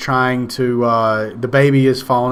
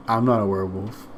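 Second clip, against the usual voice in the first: lower, softer sulky denial; offended guest; 4 semitones lower, 7 dB quieter than usual. Retold or imitated. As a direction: mumble the denial like a hurt hairy guy. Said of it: "he's just like".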